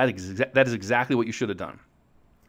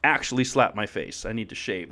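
ordinary talk

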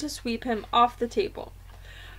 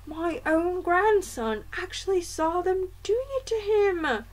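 indignant grandmother; 8 semitones higher than usual. A narrator's reading. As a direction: speak up as an affronted old grandmother.

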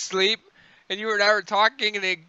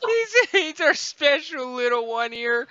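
mock tender; 5 semitones higher, slower than usual.

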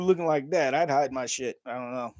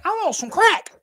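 high, loud crackhead squeal; Chappelle crackhead voice; 4 dB louder than usual. Retold or imitated. Doing the Chappelle crackhead bit for chat, high and loud. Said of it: "Dave Chappelle skits where he's like".

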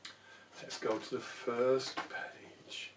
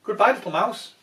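flustered fox; 6 semitones higher, 16 dB louder than usual. Acted. Fox flees.

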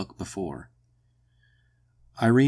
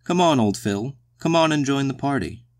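higher, louder coaxing call; crying out merrily; 6 semitones higher, 4 dB louder than usual. A narrator's reading. Mandy urging old Phil to join the party, calling merrily. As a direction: coax him gaily to join in. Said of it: "cried Mandy, laughing".

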